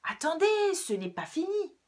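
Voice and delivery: high, loud snarling fox; hungry fox roaring after the rabbits; growling fox. Acted. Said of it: "gronda le renard".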